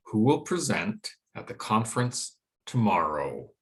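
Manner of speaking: measured phrases with pauses, falling on TOMORROW; drop at the end. Shown as a demonstration. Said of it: "we get that drop at the end".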